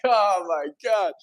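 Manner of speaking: relieved surprise. Retold or imitated. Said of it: "And I'm like".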